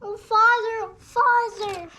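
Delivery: high, loud cry of oh father; mock lament. Child voicing the children grieving their dying father, high mock wail.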